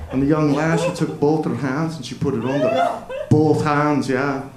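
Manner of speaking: storytelling in a put-on Scottish accent. Comic tells his kilt story to the crowd in Scottish accent. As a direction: spin a yarn as a Scotsman. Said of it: "tell a story in a Scottish accent".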